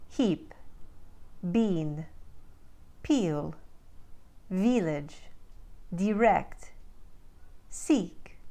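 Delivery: Ukrainian-accented word drill, short I as pure EE; one word at a time, Ukrainian accent with tight EE. Shown as a demonstration. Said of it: "Let's do a little bit more for practice".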